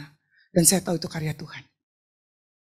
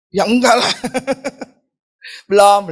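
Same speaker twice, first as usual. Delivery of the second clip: ordinary delivery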